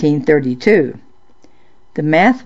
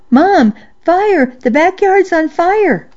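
panicked shout; 13 semitones higher than usual. Acted. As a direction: yell for help in alarm. Child alarms mom about fire.

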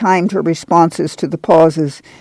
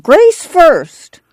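louder and higher insistent demand; Grandma clamoring louder; 12 semitones higher, 5 dB louder than usual. A narrator's reading. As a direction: insist again, harder. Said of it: "Grandma clamored".

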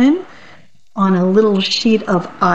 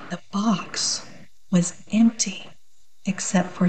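hushed reveal; 10 dB quieter than usual. A narrator's reading. Narrator hushing the children for the empty box. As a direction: drop to a near whisper for the reveal.

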